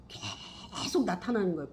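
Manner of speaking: usual speaking voice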